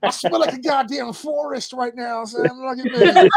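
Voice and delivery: loud, cocky macho ad voice; Sasquatch soap ad. Retold or imitated. Mocking the shirtless guest as a loud macho soap ad. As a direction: strut like a hairy soap commercial. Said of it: "looking like a Sasquatch soap commercial".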